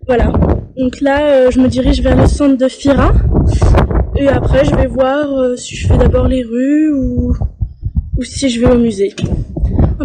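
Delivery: loud voice over the wind; shouting over wind. Real, not acted. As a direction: project over gusts of wind. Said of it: "J'espère que vous m'entendez avec le vent". (parler fort pour couvrir le vent)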